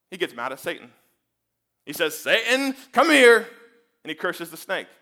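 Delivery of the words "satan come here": loud, higher commanding call; stern summons. Acted. God calls Satan out to curse him, loud and stern.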